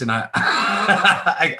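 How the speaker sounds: high laugh breaking in; laughing mid-sentence; a burst of laughter. Real, not acted.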